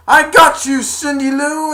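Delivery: high, loud rescuing shout; triumphant shout. Retold or imitated. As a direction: cry out as you catch her. Grinch catches Cindy.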